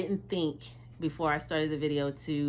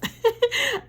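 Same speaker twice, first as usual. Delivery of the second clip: high giddy laugh; 8 dB louder than usual. Real, not acted. Joy at reveal.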